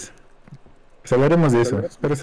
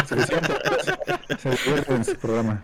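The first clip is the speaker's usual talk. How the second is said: laughing; 8 semitones higher than usual. Real, not acted. Laughs after host's remark.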